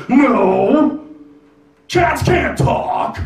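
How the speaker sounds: high, slow defensive denial; overly defensive. Retold or imitated. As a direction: protest way too much. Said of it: "really overly defensively".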